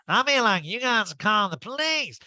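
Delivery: exaggerated, sing-song caricature of a burglar. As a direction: ham up the crook's confession. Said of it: "And he said".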